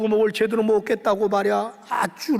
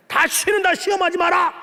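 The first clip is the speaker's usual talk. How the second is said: shouted, raised warning; angry God; 5 semitones higher than usual. Acted. God scolds grumbling Israel, angry shout. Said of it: "그러면서 하나님 화내잖아요".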